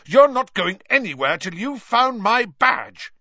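high, loud furious order; angry, full-voiced demand; yelled. A narrator's reading. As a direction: yell as an outraged brother. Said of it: "yelled Percy".

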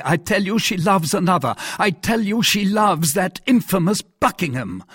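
high, vehement monarch; jealous king. Acted. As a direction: drive home each accusation insistently.

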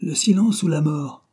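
narrated jeering shout, pitched high; sneering cry. A narrator's reading. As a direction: call out a threat with a touch of a sneer. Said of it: "cria une autre voix en ricanant".

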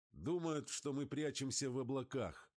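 excited high-pitched call. A narrator's reading. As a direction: call it out high over the engine. Pilot calling out in flight.

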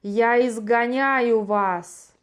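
slow, loud shouted command. Acted. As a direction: hurl the words at the spirit. Exorcising the curse, shouting.

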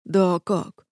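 at a loss, mildly flustered; bewildered. A narrator's reading. Commander answers about the botched renovation, bewildered. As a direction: say it lost for words. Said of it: "растерянно проговорил Азал".

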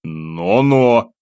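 mild mock-indignant warning. A narrator's reading. Teased student protesting the insult to his friend, indignantly. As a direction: object with a drawn, rising protest. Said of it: "возмутился я".

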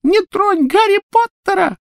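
very high, loud little squeak; squeaky house-elf. A narrator's reading. Tiny elf defending the boy from his old mistress, squeaking. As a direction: pipe it up in defiant squeaks. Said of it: "пропищал он".

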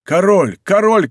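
two rising, excited cries; shouting. A narrator's reading. Soldiers mistaking Winter for the king, shouting. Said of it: "кричали многие".

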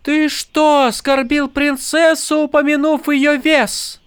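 high, shocked question; aghast at the insult; horrified. A narrator's reading. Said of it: "В ужасе переспросила Тин".

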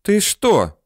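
offended, high exclamation; indignant. A narrator's reading. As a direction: object as if wronged. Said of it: "возмутилась она".

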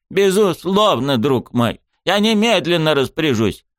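high, eager pompous mayor; obliging mayor voice. A narrator's reading. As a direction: agree at once as the mayor.

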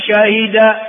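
شاهد as shahida, kasra on the ha; original verb form. Shown as a demonstration. Teacher listing four verb variants, شاهد said shahida. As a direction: say شاهد as sha-hi-da. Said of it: "أربع لغات".

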